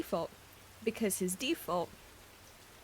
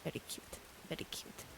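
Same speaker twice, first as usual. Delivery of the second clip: soft whispered approval; hushed whispered coo; 3 semitones lower, 11 dB quieter than usual. Real, not acted. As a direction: whisper it, charmed. Whispering admiration.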